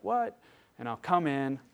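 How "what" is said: higher, curt daughter's reply; lightly voiced tearful girl calling through a door; upset daughter. Crying daughter answering dad's knock, higher-pitched. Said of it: "she's like".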